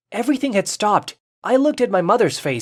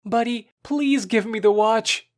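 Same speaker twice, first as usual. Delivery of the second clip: pleading; 5 semitones higher, slower than usual. Acted. Boy begs his friend to return the watch, pleading. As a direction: beg gently for it back. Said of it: "I said in a pleading voice".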